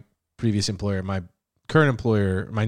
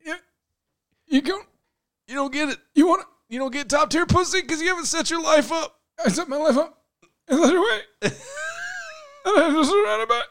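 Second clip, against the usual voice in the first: much higher put-on lecturing voice, cracking into laughs; mocking Mystery. Pickup artist lecturing while dying, high mock voice. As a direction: preach in a squeaky cartoon voice. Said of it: "he's negging me still".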